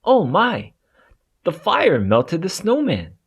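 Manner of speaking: ordinary delivery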